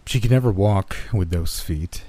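ordinary delivery

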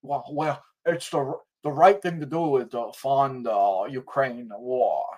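slow, lightly voiced old senator; mildly mock Mitch McConnell's drawl; imitating McConnell. Mocking McConnell's slow voice to Brett. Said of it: "he says".

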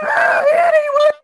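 loud, very high cartoon shriek; hammed-up squealing yell; Jar Jar Binks. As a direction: shriek the name in a goofy falsetto. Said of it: "Jar Jar Binks".

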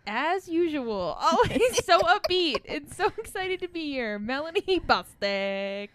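drawn-out, high, loud announcer tease; mock-hype introduction. Host teasing her chill co-host, mock-enthusiastic intro. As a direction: gush with sarcastic excitement.

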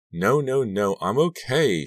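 firm, slow, rising insistence; insisting. Acted. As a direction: insist she is fine, slowly. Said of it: "she insisted".

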